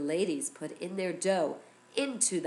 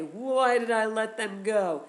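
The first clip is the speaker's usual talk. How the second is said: higher mock wail of regret; regretful Paro; 4 semitones higher, 4 dB louder than usual. Teacher voicing Paro after he says go, wailing regret. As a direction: wail it like a king who regrets it.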